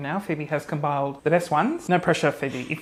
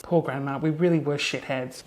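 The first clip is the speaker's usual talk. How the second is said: ordinary talk, nothing marked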